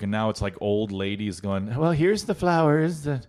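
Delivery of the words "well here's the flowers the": put-on high sweet old lady; chirpy grandma showing off. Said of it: "I like your old lady voice".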